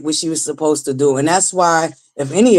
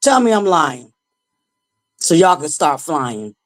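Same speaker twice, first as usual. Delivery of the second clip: normal talking voice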